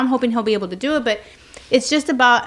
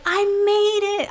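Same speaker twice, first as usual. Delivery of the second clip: put-on proud kid voice; 10 semitones higher, slower than usual. Kids proudly announcing.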